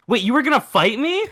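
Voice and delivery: high-pitched incredulous question; retold shock. Friend learning guy meant to fight, mock shocked. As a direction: imitate a friend's stunned realization.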